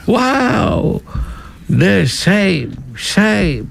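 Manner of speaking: put-on high, drawn-out jeer with an accent; heckling British MPs. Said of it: "Και με προφορά επίσης". (μίμηση Βρετανών βουλευτών που αποδοκιμάζουν με στυλ και προφορά)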